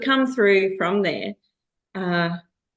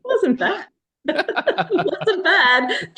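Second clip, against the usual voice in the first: laughing; 11 semitones higher, much slower than usual. Real, not acted. Laughing at his bad accent.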